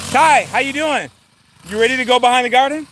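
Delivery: friendly holler, pitched well up; calling out. Real, not acted. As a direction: call across the yard to your son. Calls out to his son.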